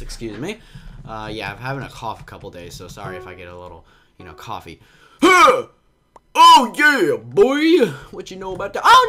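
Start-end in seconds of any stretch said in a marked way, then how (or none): 5.2-9.1 loud, high burst of triumph; sudden gamer cheer, high and loud; excited whoop. Real, not acted. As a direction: whoop it out like you just scored. Sudden win in the game.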